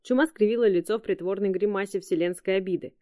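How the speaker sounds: plain reading voice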